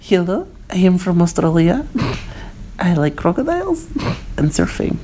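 high, hammed-up failed Australian accent; badly faked Australian accent, pitched high. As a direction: do a goofy Aussie tourist voice. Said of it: "Poor Australian Thor".

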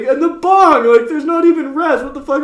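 high, loud indignant complaint; mock outrage. Roommate complaining about the clean bong, high and loud. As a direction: whine it like a wronged friend. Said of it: "He's like".

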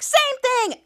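high-pitched mock protest; play-acted indignation; exasperated retort. Acted retort to Henry's correction.

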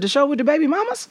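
high, quick, mocking question; sassy retort. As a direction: throw the question back with sass. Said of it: "I said".

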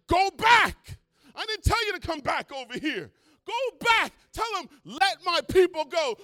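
high loud commanding shout; God shouting. Acted. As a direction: drive them back to Pharaoh. God sends Moses back.

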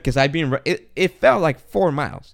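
ordinary talk

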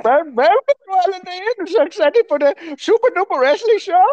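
high, hammed-up clerk voice; mocking a hotel clerk, pitched high. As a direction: ham up a sing-song front-desk greeting. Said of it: "who talks like this".

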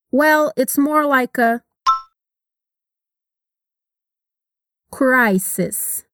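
very slow, careful sentence; slow model reading. Shown as a demonstration. Teacher modeling the line slowly. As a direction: read it slowly for repetition. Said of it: "Capricha aí no som do S".

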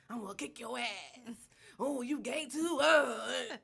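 mocking imitation of a bully; imitating attackers. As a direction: act out a hammed-up threat, building. Acts out imagined attackers.

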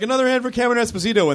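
high, fast MC call; emcee announcement. Pretend. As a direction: rattle it off like an MC. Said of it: "What if I was like".

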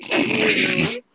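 high, loud grossed-out squeal; hammed-up revulsion; retold disgust. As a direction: recoil vocally from something slimy. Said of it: "And I'm like".

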